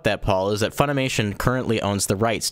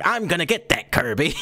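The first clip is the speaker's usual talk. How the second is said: higher, cartoon mobster threat; King Dedede's mob boss voice; 7 semitones higher than usual. Host imitates King Dedede threatening Kirby, cartoon mobster voice. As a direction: menace Kirby like a wiseguy. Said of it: "but it's like".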